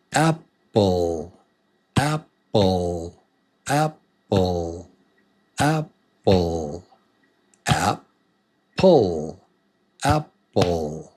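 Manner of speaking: Apple split in two with a gap between syllables; two separate beats. Shown as a demonstration. Teacher counting syllables for learners, Apple broken into two beats. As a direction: say Apple as ap, pause, pull.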